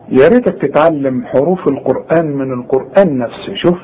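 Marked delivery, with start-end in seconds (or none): none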